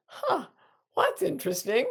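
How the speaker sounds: a high, amused "Huh" of mild surprise; lightly intrigued, pitch jumping up on huh; mild curious surprise. Pretend. Someone distracted by a cat in a sunbonnet, mildly surprised. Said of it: "looking at a cat in a sunbonnet and going".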